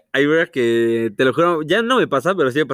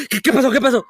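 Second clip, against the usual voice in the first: startled mom imitation; 9 semitones higher than usual.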